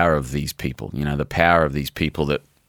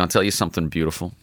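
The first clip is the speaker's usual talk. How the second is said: usual speaking voice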